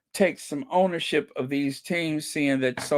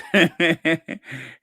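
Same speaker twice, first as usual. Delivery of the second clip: laugh; 2 semitones higher, 4 dB louder than usual. Real, not acted. Reading a funny comment.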